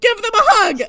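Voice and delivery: high, loud plea at the TV; exasperated yelling. Acted. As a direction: yell at the characters, begging in exasperation. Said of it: "I find myself just yelling at characters".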